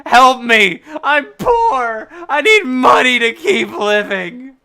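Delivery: loud, high, hammed-up plea; desperate begging. Player begs again after a refusal, loud mock-desperate plea. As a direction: cry out for help, overdoing the desperation.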